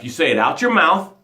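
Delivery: usual speaking voice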